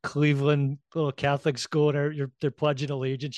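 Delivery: normal talking voice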